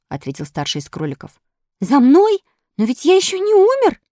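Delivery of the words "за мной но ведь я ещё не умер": high, loud alarmed protest; alarmed puppet. Acted. Puppet told rabbits came for him, alarmed. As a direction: protest in panic, voice shooting up.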